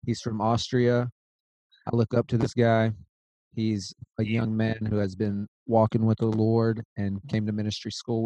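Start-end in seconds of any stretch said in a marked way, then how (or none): none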